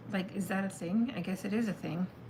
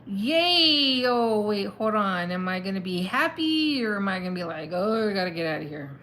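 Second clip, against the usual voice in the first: voicing the deciding brain; 7 dB louder than usual. Reader acts out a decision-making brain, loud mock glee turning to doubt. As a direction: play a brain cheering then second-guessing. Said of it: "It's like".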